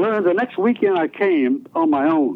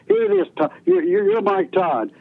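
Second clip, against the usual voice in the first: much higher, thrilled reveal of the new name; excited announcing. Retold or imitated. Coworker naming the new DJ, excited and much higher. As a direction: proclaim it like a big discovery. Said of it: "He said".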